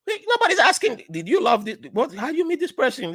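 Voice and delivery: high, exasperated objection; high-pitched mock indignation; incredulous protest. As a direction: act out baffled protest at the relatives. Acting his protest at family's reaction.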